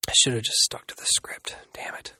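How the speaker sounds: hushed self-scolding mutter; whispered aside. Real, not acted. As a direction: whisper it to yourself.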